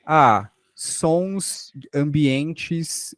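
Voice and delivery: normal talking voice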